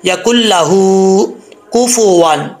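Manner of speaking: लहू stretched one alif long; slow chanted या कुल लहू कुफुवन; slow recitation. Shown as a demonstration. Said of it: "लंबा करके पढ़ेंगे".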